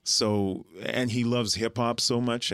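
normal talking voice

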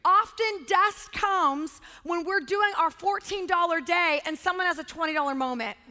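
ordinary talk

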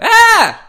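very high, very loud comic shriek; mock scream. Mimicking screaming comedians for friends, high loud shriek. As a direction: scream it like a squealing movie comedian. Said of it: "The louder the pitch".

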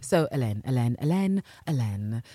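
"Hélène" repeated as eh-LEN, silent H; French pronunciation. Shown as a demonstration. Host practises the corrected name, saying Hélène the French way. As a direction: let "Hélène" roll off the tongue, stressed on LEN. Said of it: "Helen in French, let it roll off your tongue".

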